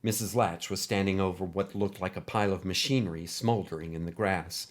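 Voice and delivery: ordinary delivery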